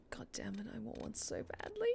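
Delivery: unvoiced whisper of longing; hushed mock lament; whispered craving. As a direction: breathe it out like a secret craving. Craving pastry, whispered quote.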